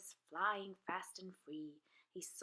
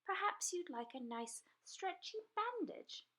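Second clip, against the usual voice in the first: put-on higher, helpful little girl; higher little girl offering help; little girl's voice; 10 semitones higher than usual. A narrator's reading. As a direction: offer it kindly like a small girl. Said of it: "She said".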